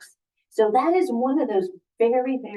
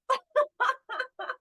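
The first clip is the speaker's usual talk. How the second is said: high, giggly laugh; story laughter; 14 semitones higher, 4 dB quieter than usual. Acted. Reader laughs along with children as Elephant does, high laughter. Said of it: "laughing along with your children".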